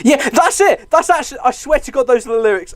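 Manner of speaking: high-pitched, vindicated outburst; excited insistence. Real, not acted. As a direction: insist eagerly, sure of being right. Vindicated about remembered lyrics.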